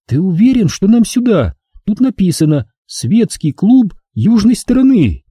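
put-on young woman, uneasy; Angela's voice. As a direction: voice the worried girlfriend. Angela's voice in narration.

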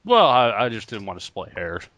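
ordinary talk, nothing marked

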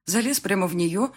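plain reading voice

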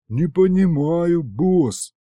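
high, slow, confused drawl; bewildered drawl. A narrator's reading. Demon doesn't understand his boss, bewildered. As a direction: stretch it out, quite lost. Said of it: "как-то очень растерянно протянул он".

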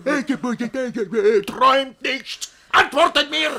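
high, loud barked orders; furious demand. Acted. Master demands servants speak at once, shouting. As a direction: force an answer now.

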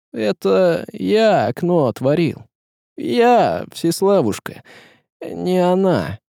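high, halting confession; crestfallen. A narrator's reading. Tsar confessing to his wife, crestfallen. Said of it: "сознался упавшим голосом".

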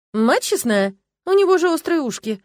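loud, shooting up high in amazement; astonished exclamation. A narrator's reading. Girl notices the youth's pointed ears, amazed. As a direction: blurt out the startling discovery.

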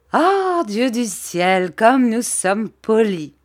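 high, loud, drawn-out cry of amazement; mock exclamation. A narrator's reading. Little woman teasing two over-polite gentlemen, exclaiming in mock amazement. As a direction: exclaim grandly, starting high and slowing down. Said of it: "s'écria miss Mowcher".

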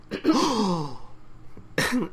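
high, drawn-out mock gasp. Gintoki reacting to Katsura calling it cute, mock gasp. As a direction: gasp in exaggerated shock.

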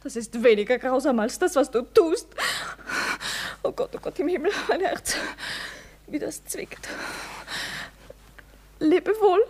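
tearful reproach sinking into a faint farewell; sobbing, breaking off between phrases; weeping. Acted. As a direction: cry through a broken-hearted goodbye. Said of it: "warum weinst du".

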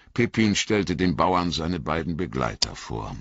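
plain reading voice

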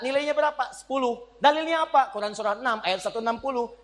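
normal talking voice

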